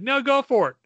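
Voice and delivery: a hint of a careless yes-man; mock director.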